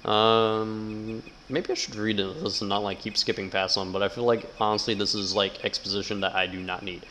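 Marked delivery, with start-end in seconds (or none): none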